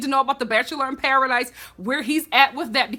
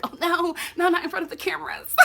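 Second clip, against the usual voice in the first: prissy protest, higher and faster, laughing; mock prim refusal; 5 semitones higher, 4 dB quieter than usual. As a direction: giggle it like a prude fending him off. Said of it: "I'm like".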